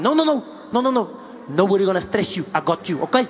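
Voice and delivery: put-on lightly accented nervous purser; hint of a South African purser; flight attendant. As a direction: fuss over the celebrity. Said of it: "I said".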